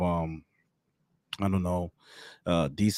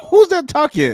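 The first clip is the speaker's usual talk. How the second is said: startled squeak; 10 dB louder than usual. Retold or imitated. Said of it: "I'm like".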